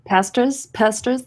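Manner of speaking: pasta said as "Pastors?" with her accent; "Pastors?" as her own accented pasta, a questioning rise. Real, not acted. She repeats her accented "Pastors?" to a confused host. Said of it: "it's my accent".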